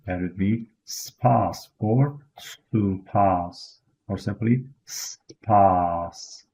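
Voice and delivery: "spas" and "stupas" said very slowly; Kurdish spas. Shown as a demonstration. Teacher models Kurdish thanks very slowly. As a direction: stretch out "spas" for learners. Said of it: "How do you say thanks".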